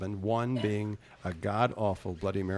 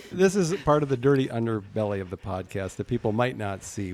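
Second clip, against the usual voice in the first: ordinary delivery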